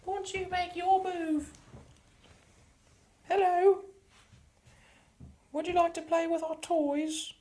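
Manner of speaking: creepy doll voice. Acted. Taunting the spirit in a creepy high childlike voice.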